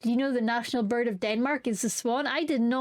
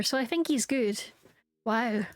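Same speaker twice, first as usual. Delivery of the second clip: ordinary talk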